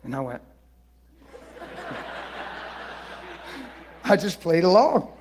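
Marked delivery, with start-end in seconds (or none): 1.3-3.7 silent wheezing laugh. Real, not acted.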